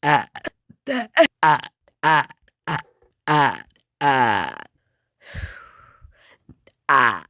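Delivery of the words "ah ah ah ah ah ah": belch it out; burping. Real, not acted. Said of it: "at these burps off camera".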